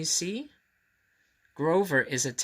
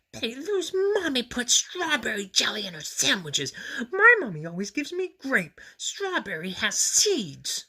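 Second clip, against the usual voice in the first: Grover whispering; 11 semitones higher than usual. A narrator's reading. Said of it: "he whispered to Harry".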